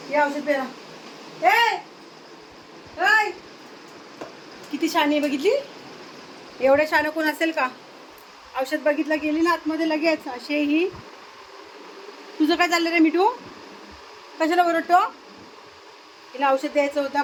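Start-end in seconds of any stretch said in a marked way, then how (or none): 1.4-3.3 high, loud call across the yard. Real, not acted. Calling out to animals. 12.4-15.0 higher, louder teasing scold; scolding the parrot. Real, not acted.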